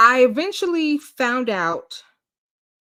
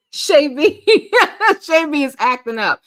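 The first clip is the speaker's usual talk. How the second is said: laughing loudly while talking; 6 semitones higher, 7 dB louder than usual. Real, not acted. Laughing at viewer Shay B's comment, loud. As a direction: laugh through the name.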